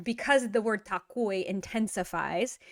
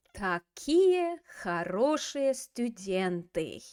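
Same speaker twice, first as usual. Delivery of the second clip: slow model phrase; much slower than usual. Shown as a demonstration. Teacher models phrase slowly.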